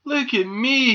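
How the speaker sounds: high, loud show-off call; proud shout. A narrator's reading. Squirrel swinging along on new crutches, proud shout. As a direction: shout for everyone's attention. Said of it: "he shouted".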